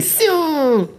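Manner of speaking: high shouted Siu like Cristiano; Siu celebration cry. Acted.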